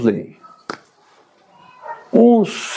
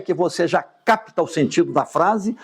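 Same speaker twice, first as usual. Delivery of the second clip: ordinary talk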